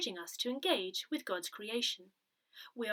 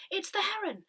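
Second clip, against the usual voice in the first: higher, eager call of a sighting; excited cry; 9 semitones higher than usual. Retold or imitated. Someone at the front window spotting the heron, excited high call. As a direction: sing out the news, pitched up. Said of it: "the cry goes up".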